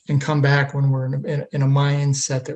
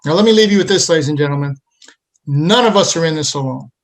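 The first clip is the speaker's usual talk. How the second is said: motivational host; 4 dB louder than usual. Acted. Host's motivational closing to viewers.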